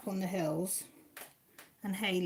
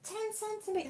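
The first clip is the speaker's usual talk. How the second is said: "Ten centimetres" pitched much higher in disbelief; amazed; 13 semitones higher than usual. Real, not acted. Reading out the snow forecast, amazed. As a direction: gasp out "Ten centimetres" like it's huge. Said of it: "that's a hell of a lot".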